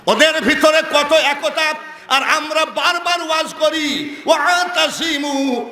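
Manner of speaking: high, ringing sermon delivery; full-force preaching. Acted. Preacher asks the crowd about weeping enemies, preaching at full force.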